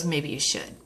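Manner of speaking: low, soft, deadpan; husband gently nudging her toward the business, low and soft; quiet suggestion. Retold or imitated. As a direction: say it softly, almost as an aside.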